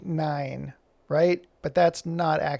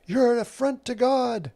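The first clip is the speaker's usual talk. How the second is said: high, pinched mock yell at the drag person; mock screaming; 7 semitones higher than usual. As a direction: imitate a zealot shouting, half-hearted. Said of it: "the person screaming at them".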